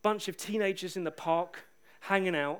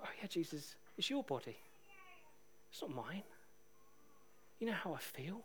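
very soft, humbled murmur; hushed reply; 15 dB quieter than usual. Retold or imitated. Preacher retelling his answer to Jesus, hushed.

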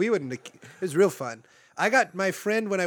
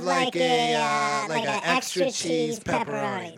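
prank call voice; 9 semitones higher, 4 dB louder, slower than usual. Pretend. Prank call pizza order voice.

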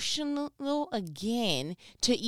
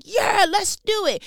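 eager yes; 10 semitones higher, 6 dB louder than usual. Retold or imitated. Imagined eager agreement.

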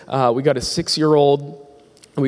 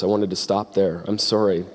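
ordinary talk

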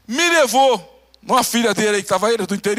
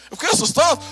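usual speaking voice

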